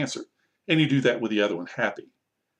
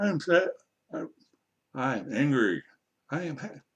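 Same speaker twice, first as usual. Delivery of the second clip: higher-pitched model sentence; demonstrating to learners; 3 semitones higher than usual. Shown as a demonstration. Teacher modelling feelings for language learners, raised pitch.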